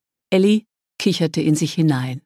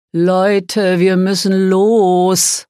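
desperate call, higher, louder and drawn out; verzweifelter Ruf; 5 semitones higher, 5 dB louder, much slower than usual. A narrator's reading. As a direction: call out slowly in exasperated despair. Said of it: "rief er verzweifelt". (verzweifelt und langgezogen gerufen)

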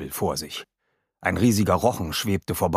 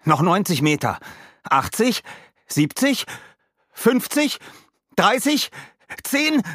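countdown climbing from raised to very high pitch; mounting urgency; 11 semitones higher than usual. A narrator's reading. Rick reads the depth off the monitor, mounting urgency. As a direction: call out each number higher, building tension.

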